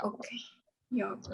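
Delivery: geography whispered to herself; repeating the word under her breath; whispered practice. Real, not acted. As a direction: mouth geography quietly to practise. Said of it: "student practising to herself".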